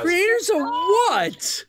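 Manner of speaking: high, slow incredulous question; disbelieving. Real, not acted. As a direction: drag it out, baffled.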